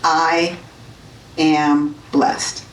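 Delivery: each word said separately; I am blessed, one word at a time; staccato. Shown as a demonstration. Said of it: "say each word separately".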